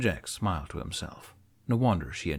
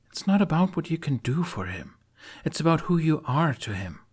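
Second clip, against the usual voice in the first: put-on higher earnest young woman; Astrid; 9 semitones higher than usual. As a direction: press the point gently.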